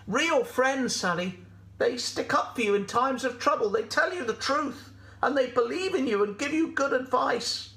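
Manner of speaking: high goat voice giving advice; Gladys the goat, earnest. Acted. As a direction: teach a friend earnestly in a high voice.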